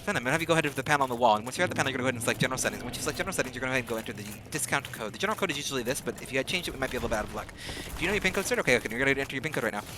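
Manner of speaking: very fast troubleshooting patter; rapid call-center script. Retold or imitated. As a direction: fire off the steps like a sped-up support agent. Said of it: "we started going like that".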